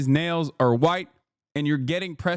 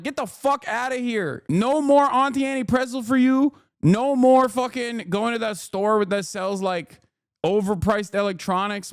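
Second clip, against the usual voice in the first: pitched-up rude cop yelling; mock angry cop; 6 semitones higher than usual. Cops kicking Roy Moore out of the mall, mock yell. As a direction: throw him out of the mall.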